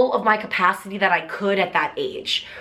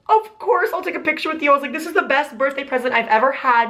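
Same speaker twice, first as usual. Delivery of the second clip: gushing yes; 6 semitones higher than usual. Retold or imitated. Thrilled reply to fan request.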